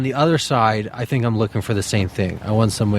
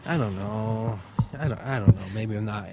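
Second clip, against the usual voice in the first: much softer, unsure mumbling; hesitant backing off; 7 dB quieter than usual. Retold or imitated. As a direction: shrink away from the plan. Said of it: "people going".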